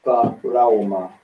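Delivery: Tarawama said as tah-rah-wah-MAH; preferred Hebrew pronunciation. Shown as a demonstration. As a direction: say Tarawama with a wa before the final ma. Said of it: "as I prefer to say it".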